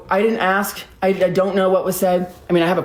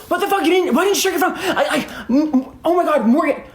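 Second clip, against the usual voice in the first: high-pitched, stammering panicked rant; breathless-sounding mock pleading, pitched high; frantic fast talk; 7 semitones higher than usual. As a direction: mimic someone jabbering frantically. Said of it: "he's talking so fast".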